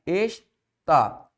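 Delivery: "Está" split in two, Es, pause, tá; "Está" said syllable by syllable; separated syllables. Shown as a demonstration. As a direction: break "Está" into its syllables with a clear gap.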